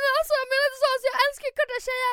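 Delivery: high, rushed mock panic; imitated shocked backpedalling. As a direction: imitate a flustered guy hurrying to excuse himself. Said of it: "han hamnade i chock". (härmad chockad bortförklaring)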